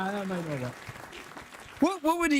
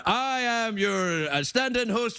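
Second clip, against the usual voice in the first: lightly voiced Scottish accent as a puppet host; a hint of Scottish, played as the puppet Drew; 4 semitones higher, 3 dB quieter than usual. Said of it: "I'm using this Scottish accent".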